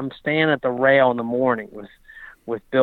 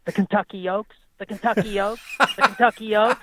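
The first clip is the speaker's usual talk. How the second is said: imitating Frankel; 9 semitones higher than usual. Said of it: "He just kept saying".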